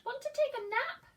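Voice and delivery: the cat offers a nap in a high voice. Acted. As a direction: ask in a high kitty voice. Cat invites spider.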